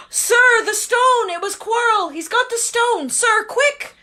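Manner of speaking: high, loud urgent outburst; urgent alarm. Acted. Harry warning Dumbledore about the stone, high and urgent. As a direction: blurt it out in a panic.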